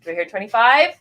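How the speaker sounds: calling out bids to the room; auctioneer call. Acted. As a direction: ask for the next bid like an auctioneer.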